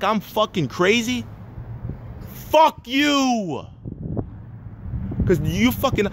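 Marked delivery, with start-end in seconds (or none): 2.5-3.7 higher, drawn-out furious curse; angry outburst. Real, not acted. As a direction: hurl it at them, stretching the last word. Ranting at servers who expect tips.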